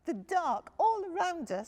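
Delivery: high, small frightened cub voice; Little Bear. A narrator's reading. Little cub telling dad he's scared.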